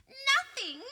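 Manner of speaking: high, taunting, sing-song poltergeist voice; high mischievous sneer, drawn out; mocking poltergeist. A narrator's reading. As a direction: tease them like a gleeful prankster spirit. Peeves voiced by narrator.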